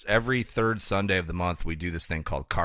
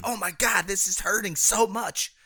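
high squeal; 12 semitones higher than usual. Retold or imitated. Retelling painful soap bubble.

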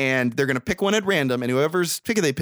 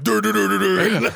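silly nonsense syllables, a little louder; mock self impression; 6 semitones higher, 4 dB louder than usual. Mocking his own way of talking with silly babble. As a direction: babble like a goofball. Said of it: "this is how I talk".

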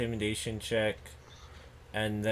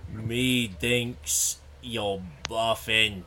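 ordinary delivery

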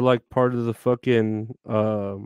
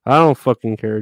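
much louder, fed-up snap; angry outburst; 11 dB louder than usual. Real, not acted. Streamer fed up with the eBay buyer, loud angry outburst. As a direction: blow up in frustration.

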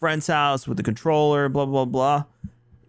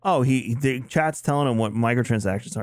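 normal talking voice

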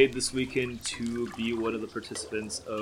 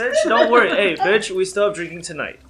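rowdy banter; 8 semitones higher, 4 dB louder than usual. Real, not acted. Rowdy reassurance to friends.

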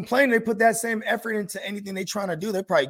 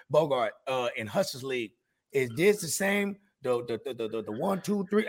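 lower, stammering imitation of a clueless friend; mock fumbling caller; 9 semitones lower, 6 dB quieter than usual. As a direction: stumble through it like he can't count. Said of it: "he would call me constantly".

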